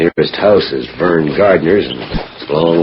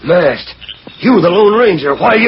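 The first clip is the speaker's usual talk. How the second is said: startled, pitch shooting up; sudden shock of recognition; shocked outburst; 12 semitones higher, 3 dB louder than usual. Acted. Beasley shocked to see Lone Ranger.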